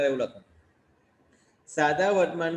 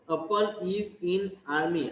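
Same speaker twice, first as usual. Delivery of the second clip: very slow, word by word English dictation; 4 semitones higher, much slower than usual. Shown as a demonstration. Teacher dictating the sentence slowly to the class. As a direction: read each word out slowly for copying. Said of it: "teacher dictating English sentence".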